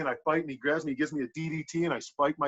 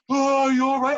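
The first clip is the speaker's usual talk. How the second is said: startled concern; 12 semitones higher, 6 dB louder than usual. Retold or imitated. Opponent shocked at bleeding head.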